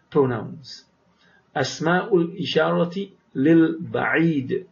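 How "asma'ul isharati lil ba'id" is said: slow model reading. Shown as a demonstration. Teacher models the phrase for students, slowly. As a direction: spell out each syllable of Asma'ul isharati lil ba'id slowly. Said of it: "teacher models Arabic phrase slowly".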